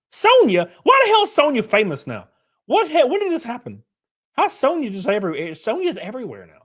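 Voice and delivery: high, loud, incredulous outburst; shocked disbelief. Real, not acted. Streamer reacting to Sonya being famous, loud shocked disbelief.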